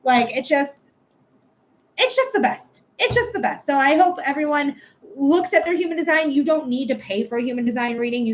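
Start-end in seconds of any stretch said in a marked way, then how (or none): 2.0-3.6 gushing. Real, not acted. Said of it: "gushing repeat".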